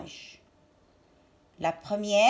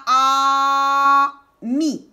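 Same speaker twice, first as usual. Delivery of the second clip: A held long and loud, then short MI; syllable by syllable; 2 semitones higher, 8 dB louder than usual. Shown as a demonstration. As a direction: stretch A, split off MI. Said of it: "Où est-ce que j'entends le A?".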